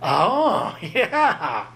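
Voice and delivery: slow-dawning "oh, oh, yeah"; mock dawning realization. Preacher voicing a listener catching on. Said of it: "be like".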